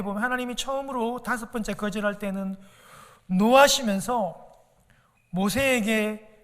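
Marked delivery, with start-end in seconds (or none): 3.2-6.3 노하시면서 drawn out slowly and louder; slow weighty emphasis. Acted. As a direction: lean on 노하시면서, slow and heavy. Slow heavy emphasis on anger. (노하시면서를 천천히 힘주어)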